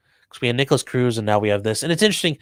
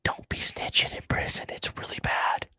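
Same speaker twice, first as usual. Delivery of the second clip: hushed, confidential whisper; whispered warning; 12 dB quieter than usual. Pretend. Streamer advising listeners against snitching in prison, whispered warning. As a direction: whisper it like secret advice. Mock secret advice about prison.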